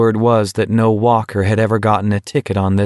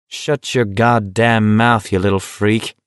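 plain reading voice

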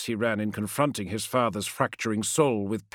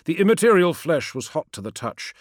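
plain reading voice

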